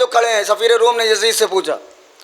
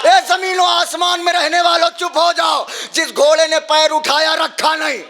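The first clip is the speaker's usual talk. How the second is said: preaching at full force; 6 semitones higher than usual. Acted. Preacher telling the mourners how the court froze, full-force preaching.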